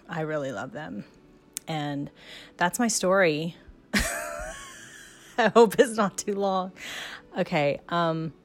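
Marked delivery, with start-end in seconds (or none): 3.9-4.6 a quick, high, soft laugh; shy high laugh. Real, not acted. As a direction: laugh off the ending. Laughs ending her recording.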